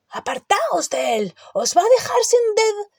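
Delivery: high, rising alarmed yell; alarmed shout. A narrator's reading. Hagrid yelling at the kids near Buckbeak, alarmed shout. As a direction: cry out to get them clear.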